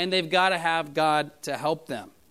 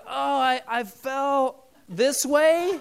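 put-on higher, drawn-out child's excuse; lightly voiced fibbing little boy; imitating fibbing child; 6 semitones higher, much slower than usual. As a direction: play a little kid making up a story, slowly. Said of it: "he's like".

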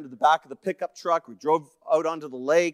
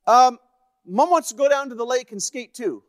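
high, loud relayed message; yelling back up; 9 semitones higher, 6 dB louder than usual. Retold or imitated. Son passes on mom's wish to skate, yelling up high. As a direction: imitate a boy hollering up to his father. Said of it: "he yells back up".

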